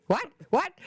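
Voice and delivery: mock-startled yelps; startled awake, imitated. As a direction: imitate someone jolted from sleep. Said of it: "he wakes up like".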